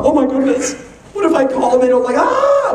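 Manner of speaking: high, rising mock freak-out; mock panic. Speaker imitating an anxious seller, high mock panic. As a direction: play someone panicking over a call. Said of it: "the addiction to drama".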